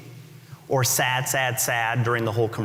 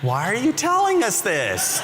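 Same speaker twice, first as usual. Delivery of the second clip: puzzled heckle; 8 semitones higher than usual. Acted. Audience puzzled at Jobs' letdown.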